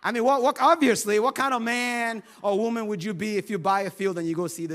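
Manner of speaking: usual speaking voice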